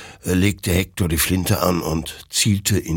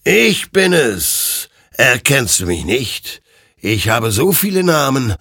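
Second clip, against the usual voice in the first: ghostly rider; 6 semitones higher, 4 dB louder than usual. Acted. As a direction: intone it like an ancient being revealing itself. The rider speaks to Hektor.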